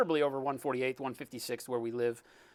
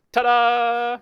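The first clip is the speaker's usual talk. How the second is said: cheery ta-da; 7 semitones higher, 10 dB louder than usual. Real, not acted. Greeting a chatter.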